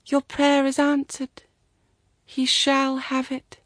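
high, measured pronouncement; solemn voice of the shrine. A narrator's reading. Voice at the shrine grants her prayer, solemn.

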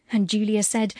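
plain reading voice

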